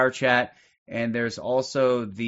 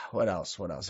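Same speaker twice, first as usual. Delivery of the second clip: fading mutter; 7 semitones lower, 10 dB quieter than usual. Real, not acted. Host muttering to himself.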